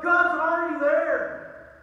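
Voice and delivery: ordinary talk, nothing marked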